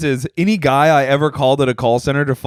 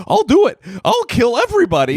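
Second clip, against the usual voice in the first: put-on high cracking squeaky kid voice; imitating a kid whose voice cracks; 8 semitones higher than usual. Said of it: "a kid, like, cracking his voice".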